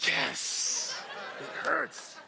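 hissed, voiceless yes of victory; mock triumph. Relieved dad celebrates the answer, mock triumphant hiss. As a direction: hiss the yes like a secret win, then pitch up.

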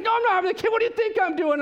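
high, loud, indignant retort; the laboring wife snapping back; wife in labor snapping at her husband, exasperated. Retold or imitated.

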